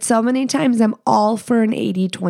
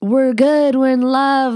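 higher, louder mock proclamation; mock screaming; 4 semitones higher, 3 dB louder than usual. Mocking TikTok couples who show off their love, mock scream. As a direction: push it up like a showy couple declaring love. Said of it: "especially when it's screaming".

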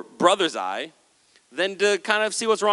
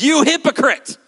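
higher, louder accusing bark; sharp rebuke; 9 semitones higher, 6 dB louder than usual. Acted. Jesus calling out the crowd, loud sharp rebuke. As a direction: hit them with the accusation.

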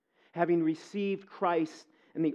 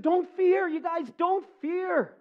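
much higher, slowing on the repeat; reassuring Joseph; 11 semitones higher, 3 dB louder, slower than usual. Retold or imitated. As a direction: comfort frightened brothers, drawing it out. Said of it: "he said".

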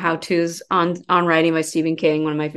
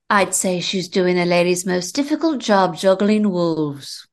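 Grace Kelly impersonation. Acted. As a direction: purr it like a 1950s film star. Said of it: "you want to hear my Grace Kelly impersonation".

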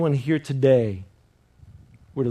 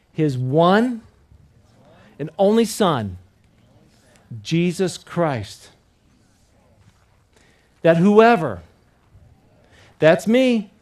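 exclamation point; 3 semitones higher, 6 dB louder, slower than usual. Shown as a demonstration. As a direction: proclaim it with full force, each phrase punched. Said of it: "you did it well with the exclamation point".

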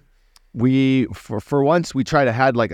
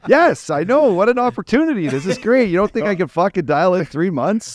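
high-pitched, louder mock protest; retold yelling; 9 semitones higher, 3 dB louder than usual. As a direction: act out yelling back in exasperation. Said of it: "I'm yelling at each other".

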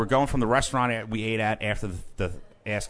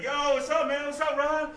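much higher imitated hail; hyped DJ greeting. DJ in booth greeting Ron, hyped. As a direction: greet him like a pumped-up DJ.

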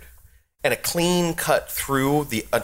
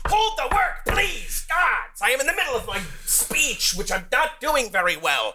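ranting goblin villain; 11 semitones higher than usual. Acted. Goblin boss rants at heroes.

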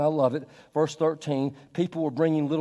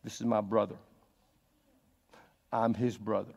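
softer, lower and tender; quiet brotherhood; 6 semitones lower, 5 dB quieter than usual. Retold or imitated. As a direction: say it close, like an arm round a shoulder. Pee Wee Reese's quiet embrace.

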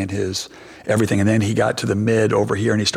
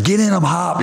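high-pitched retold sideline holler; calling out; 10 semitones higher than usual. Teammate Russell to the coach from the sideline, calling out. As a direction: yell it like a teammate egging on from the side.

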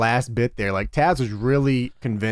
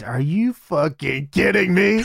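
higher, louder, outraged Taz retort; angry Taz impression; 8 semitones higher, 3 dB louder than usual. Retelling the promo, Taz snaps at Cody in a higher, louder voice. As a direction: play Taz blowing up at Cody, rising to the end. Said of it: "Taz says".

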